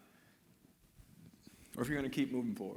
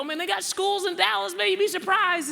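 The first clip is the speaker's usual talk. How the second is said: playful high teasing; 9 dB louder than usual. Retold or imitated. Telling a friend Dallas has schools, high playful tease. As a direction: voice jumps way up and louder, mock incredulous. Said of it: "I go".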